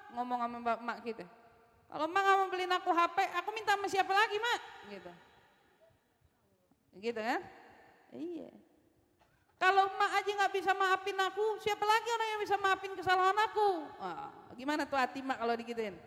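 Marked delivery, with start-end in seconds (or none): 1.9-4.6 put-on higher, fast, pleading kid voice; whiny child. Child begs his mom for a phone, whiny and fast. 9.6-13.7 put-on higher, fast, pleading kid voice; whiny child. Child begs his mom for a phone, whiny and fast.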